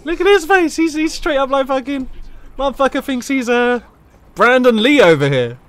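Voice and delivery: high, loud and thrilled; excited. Real, not acted. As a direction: blurt it out, amazed at the face. Excited by a character's face.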